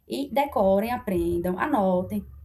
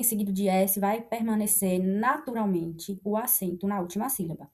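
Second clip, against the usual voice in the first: ordinary talk, nothing marked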